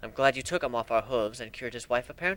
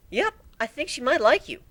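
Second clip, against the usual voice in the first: higher unicorn voice, playful; Twilight, teasing; 11 semitones higher than usual. Acted. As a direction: nudge the dragon playfully.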